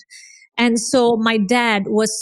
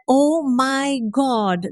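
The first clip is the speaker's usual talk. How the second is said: drawn-out, higher-pitched awe; retold amazement; 4 semitones higher, much slower than usual. Woman recalling discovering videos on mindset, amazed. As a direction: stretch it out slowly in wonder.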